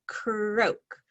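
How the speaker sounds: ordinary talk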